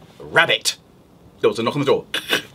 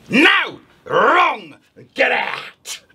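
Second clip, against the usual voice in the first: witch snapping; 7 semitones higher, much slower than usual. Acted. Witch to the wolf, cross dismissal. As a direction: throw him out crossly. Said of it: "the witch said".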